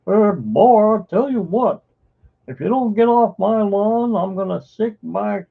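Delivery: put-on high, loud cranky old man; cranky old neighbor. Cartoon old man yelling at kids to get off his lawn. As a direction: yell like a grumpy old man shooing kids away. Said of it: "I can do their stupid voices".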